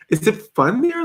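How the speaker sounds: ordinary delivery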